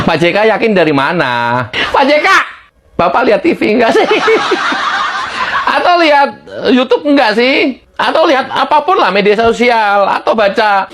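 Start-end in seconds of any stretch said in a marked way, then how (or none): 4.1-5.7 high, airy laugh; short burst of mocking laughter. Real, not acted. Laughing at Pak J K. (tertawa mengejek)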